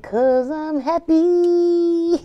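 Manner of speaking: 'Cause I'm happy, sung out slow and high; stretched gloat. Acted. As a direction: drag happy out, rubbing it in. Drawn out gloating.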